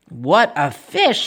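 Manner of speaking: slow, measured policeman's voice. Acted. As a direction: exclaim slowly as the officer. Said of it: "The policeman ran down with me".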